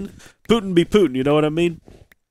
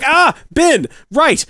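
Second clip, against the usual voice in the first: much higher, louder exasperated call; exasperated yell; 12 semitones higher, 6 dB louder, much slower than usual. Retold or imitated. Owner finds the dog barking at a bird, exasperated yell.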